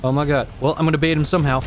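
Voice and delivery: usual speaking voice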